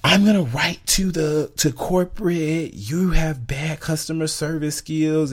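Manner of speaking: high, slow indignant threat; mock huffy customer. Imitating the rude customer threatening to write to corporate. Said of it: "she was like".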